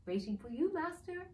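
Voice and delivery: elf with a high rise on master; fawning servant; servile elf. Acted.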